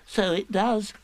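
high, small piglet voice; Piglet's voice. A narrator's reading. Piglet agreeing as the balloon comes out. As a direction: squeak it out brightly.

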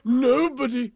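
loud, high declaration; elated king. A narrator's reading. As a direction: proclaim it grandly. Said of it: "as he kissed her tenderly".

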